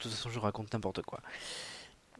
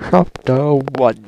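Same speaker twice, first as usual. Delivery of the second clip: much louder, slow booming chapter call; slow booming announcement; grand announcement; 17 dB louder, slower than usual. Pretend. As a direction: boom it out like a trailer voice. Announces chapter, repeated bigger.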